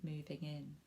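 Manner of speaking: lively storybook narration for little ones; reading to children. A narrator's reading. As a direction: read it like bedtime story time. Reads picture book to children.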